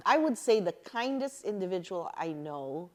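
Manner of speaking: usual speaking voice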